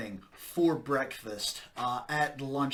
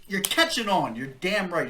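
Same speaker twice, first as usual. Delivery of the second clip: excited approval; 5 semitones higher, 7 dB louder than usual. Acted.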